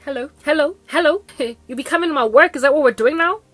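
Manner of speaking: high, loud mocking hellos with a laugh, fast on the question; sarcastic greeting. She confronts her ex at her workplace, loud and mocking. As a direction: greet him with exaggerated mock sweetness, then laugh.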